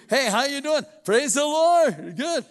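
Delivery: loud, high, beaming put-on cheer; fake cheerful greeting. Couple greeting each other at church, loud fake cheer. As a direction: gush like everything is wonderful.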